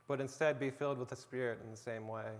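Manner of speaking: ordinary talk, nothing marked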